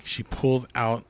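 normal talking voice

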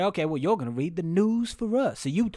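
put-on drawled Southern accent, slowing toward the end; Southern town leader. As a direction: stretch it out like a suspicious Southern boss. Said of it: "he said".

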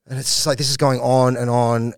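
ordinary talk, nothing marked

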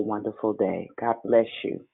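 usual speaking voice

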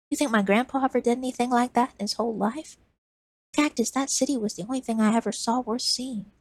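put-on a kid pouring his heart out; earnest boy. Lizard passionate.

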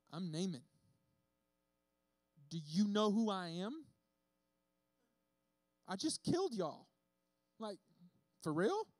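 puffed-up, offended challenge; indignant Naaman. Retold or imitated. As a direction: demand to know if he's serious. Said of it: "went away angry, just pissed".

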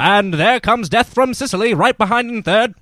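high, loud race call; race caller. Acted. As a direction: call the horses home. Continues horse race call.

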